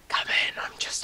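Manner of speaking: hushed, voiceless whisper. Acted. Correcting the others on calling the postman, whispered. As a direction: whisper it as if hiding. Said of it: "like, whisper, like".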